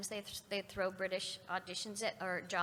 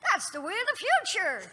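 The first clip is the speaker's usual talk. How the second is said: high, loud declaration; dramatic line delivery; 10 semitones higher, 8 dB louder than usual. Shown as a demonstration. Actor turns a chance remark into a line, dramatic. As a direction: proclaim it like a big catchphrase. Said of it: "that's a line".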